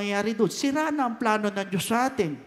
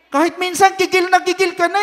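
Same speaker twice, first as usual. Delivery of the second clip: worked-up; 9 semitones higher, 7 dB louder than usual. Real, not acted. Preacher acting out rising anger.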